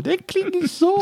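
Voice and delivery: very high ghostly voice; ghostly wail. Pretend. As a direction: squeal it like a ghost. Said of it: "Meine Geisterstimme klingt ein bisschen anders". (schrille Geisterstimme)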